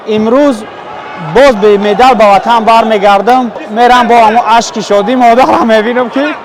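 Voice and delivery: loud lament. Acted. Fighter laments losing again.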